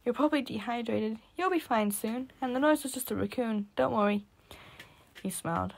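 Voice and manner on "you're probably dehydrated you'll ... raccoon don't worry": put-on lightly higher boy voice, reassuring. A narrator's reading. Boy reassures the narrator, lightly voiced. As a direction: comfort them warmly.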